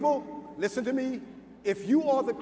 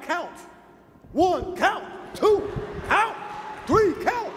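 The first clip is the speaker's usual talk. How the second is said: strict father counting; 7 semitones higher, slower than usual. Retold or imitated. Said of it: "My father would be like".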